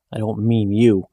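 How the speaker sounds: ordinary delivery, nothing marked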